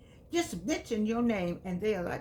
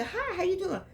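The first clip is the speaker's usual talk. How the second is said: perky greeting; 10 semitones higher than usual. Retold or imitated. Acting a friendly greeting.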